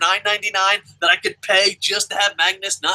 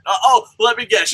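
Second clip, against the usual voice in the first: usual speaking voice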